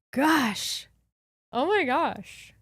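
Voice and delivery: slow, high, drawn-out oh my gosh; exhausted exclamation. Real, not acted. Podcast host after a long stretch, spent oh my gosh. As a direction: let it out like you're spent from talking.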